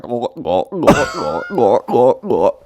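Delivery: mock gulping sound, glug glug; glugging noise. Mocking his friend's vomiting as chugging water, gurgly imitation. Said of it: "it literally sounded like".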